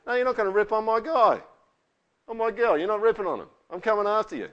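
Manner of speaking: high, loud mock warning; acted indignant outburst; protective friend. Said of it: "you just go".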